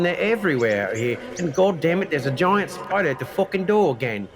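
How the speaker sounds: ordinary delivery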